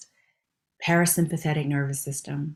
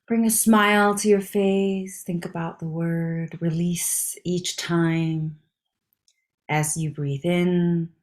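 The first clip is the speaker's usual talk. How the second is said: guided meditation; slower than usual. Acted. Instructor guiding listeners to release with each breath, slow meditation voice. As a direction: ease them through each breath, slowly.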